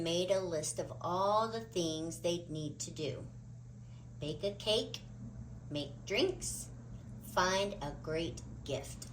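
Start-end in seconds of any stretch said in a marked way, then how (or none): none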